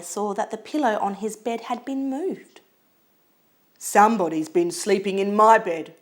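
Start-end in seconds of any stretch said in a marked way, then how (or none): 3.8-5.9 loud, growly Papa Bear, not pitched up. A narrator's reading. Papa Bear sees his pillow moved, loud and growly. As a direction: growl it out loudly as a big bear. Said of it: "in his loud growly voice".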